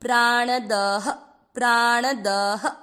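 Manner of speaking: प्राणदः, slow, clear ह at the end; slow visarga. Shown as a demonstration. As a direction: draw out प्राणदः and breathe the final ह. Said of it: "विसर्ग को पढ़ना है ह".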